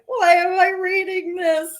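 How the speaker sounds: high and loud, close to tears; mock distressed wail. Reader retelling her reaction to the host's book, a near-tearful high wail. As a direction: cry it out in disbelief at the book. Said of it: "and I was like".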